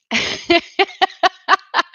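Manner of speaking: a burst of high, amused laughter. Real, not acted. Reading a viewer's comment aloud, high amused laughter.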